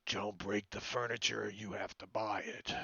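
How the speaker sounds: a lightly voiced gruff barkeep; innkeeper. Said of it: "the innkeeper says".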